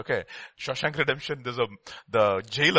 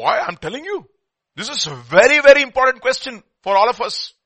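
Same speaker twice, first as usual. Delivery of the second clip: much higher, louder urgent exclamation; emphatic preaching; 10 semitones higher, 15 dB louder than usual. Acted. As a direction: stop them and drive it home. Preacher stresses key question.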